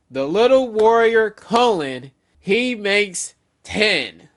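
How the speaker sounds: loud, slow, gloating announcement; gloating villain. Pretend. Villain gloating to the giants as he counts captives. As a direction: savor each word of the count.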